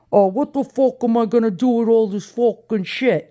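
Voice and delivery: imitating a Brooklyn Italian complaining; mock exasperated Brooklyn-Italian accent. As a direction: put on a thick Brooklyn Italian guy. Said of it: "that's how Italians talk from Brooklyn".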